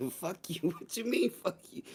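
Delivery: amused comeback through laughter; laughing retort. Real, not acted. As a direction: laugh while firing back, as if mock-offended. Laughing at the hot sauce question.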